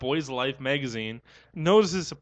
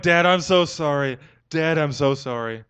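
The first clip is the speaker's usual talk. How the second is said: pleading apology; 7 dB louder than usual. Retold or imitated. Son begs disappointed dad's forgiveness, loud mock pleading. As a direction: plead with Dad, louder each plea than normal talk.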